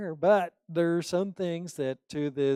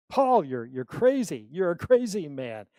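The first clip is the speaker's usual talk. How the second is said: louder exasperated yell; shouting; 3 semitones higher, 7 dB louder than usual. Retold or imitated. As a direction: shout him down as crazy. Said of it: "he shouted".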